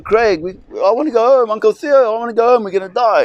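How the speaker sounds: put-on high panicked wail of a boy; imitating a frightened child yelling to go home; terrified screaming kid. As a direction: scream it like a kid sure he's going to die. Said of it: "he's just screaming".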